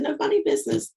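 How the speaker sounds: high, emphatic no funny business; firm warning. Retold or imitated. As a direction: put your foot down, playfully. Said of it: "I said".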